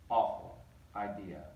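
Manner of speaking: ordinary delivery, nothing marked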